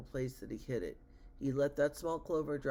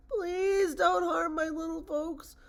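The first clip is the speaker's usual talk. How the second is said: begging; 11 semitones higher, 6 dB louder than usual. A narrator's reading. Elephant chasing the eagle pleads for little folks, begging. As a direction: wail out a desperate appeal. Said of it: "He begged".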